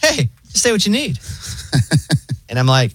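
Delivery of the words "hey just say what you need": high, laid-back AI operator voice; so chill robot voice. Retold or imitated. Retelling the pharmacy AI to friends, high chill robot voice. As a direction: imitate a relaxed robot receptionist, pitched high. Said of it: "the robot voice is, like, so chill".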